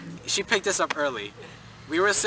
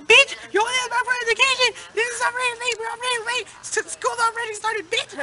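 high, fast mock yelling; mock angry kids. Student re-enacts classmates yelling at driver, high fast mock yell. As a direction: shriek it like furious students. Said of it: "Could you talk like her".

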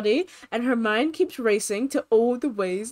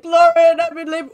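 higher, louder call for Lauren; mock loud call; 6 semitones higher, 11 dB louder than usual. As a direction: shout the news across the room to Lauren.

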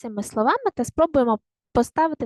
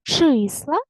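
Числа with a long stressed first syllable; 3 semitones higher, 4 dB louder than usual. Shown as a demonstration. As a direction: stretch ЧИ in Числа. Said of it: "Поставте усно наголос". (Числа з протяжним наголосом на ЧИ)

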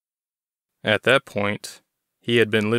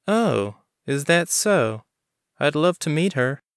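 higher, drawn-out knowing woman's voice; Bonnie teasing; 6 semitones higher than usual. A narrator's reading. Clerk Bonnie teasing Chris about a girl, higher knowing voice. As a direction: tease him, savour the words.

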